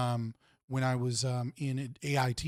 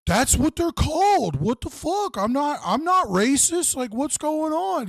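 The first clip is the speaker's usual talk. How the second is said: high, louder, slow, baffled protest; clueless Alaska guy; 12 semitones higher, 5 dB louder, slower than usual. Retold or imitated. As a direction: ham up a naive guy who doesn't get the problem. Retelling Alaska guy defending himself.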